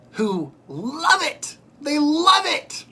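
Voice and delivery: high, slow, disbelieving love it; loud, high outraged disbelief; incredulous. Real, not acted. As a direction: stretch it out in disbelief. Incredulous about family loving masks.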